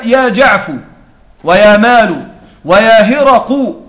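"يا جعف" "ويا مال" "ويا هرق" each ending in a damma, the last slowly. Shown as a demonstration. Teacher to students, demonstrating the damma endings of "يا جعف" and "ويا هرق". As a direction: round off every final letter with a u. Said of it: "بضم أعجازهن".

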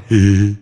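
short mock giggle; imitated chuckle. Client laughs when picturing father, imitated chuckle. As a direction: chuckle as if suddenly tickled. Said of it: "dia malah ketawa".

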